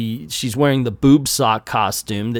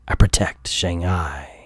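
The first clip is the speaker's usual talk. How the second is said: noir detective; 8 semitones lower than usual. Acted. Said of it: "I'm gonna read it like a noir".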